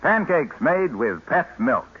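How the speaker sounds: normal talking voice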